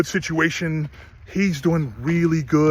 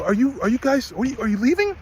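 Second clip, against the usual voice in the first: worried question; 7 semitones higher than usual. Retold or imitated. Retold anxious question to departing owner.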